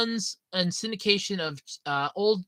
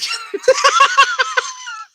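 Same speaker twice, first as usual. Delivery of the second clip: a high, loud burst of laughter; cracking up at the name; loud laughing shriek; 11 dB louder than usual. Real, not acted. Reacting to chat's Raw Dog name with a laugh shriek.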